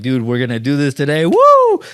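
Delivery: pumped, whooping yell at the end; hyped-up cheer. Retold or imitated. Eager first-time surgeon psyching himself up with a whoop. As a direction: fire yourself up with a big woo.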